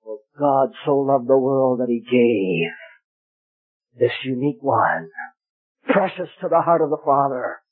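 normal talking voice

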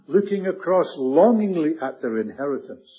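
normal talking voice

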